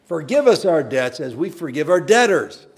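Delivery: loud, high preaching prayer; fervent prayer. Real, not acted. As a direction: pray it out with full force. Corrected prayer line, full force.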